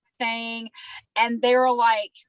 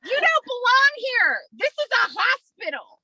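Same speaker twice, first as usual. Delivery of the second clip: high, loud, indignant re-enacted shout; yelling for security; 13 semitones higher, 5 dB louder than usual. Patient ordering tourists away from the hospital, yelling. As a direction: holler for the guard, then tell them off. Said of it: "like, I'm yelling, I'm yelling because".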